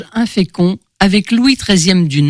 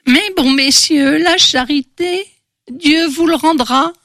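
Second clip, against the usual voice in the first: put-on high, pleading old crone; old beggar woman; 9 semitones higher than usual. A narrator's reading. Bent old beggar asking two gentlemen for alms, high pleading voice. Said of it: "une mendiante courbée par les ans".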